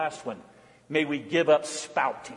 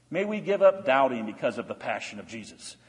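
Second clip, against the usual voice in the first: ordinary talk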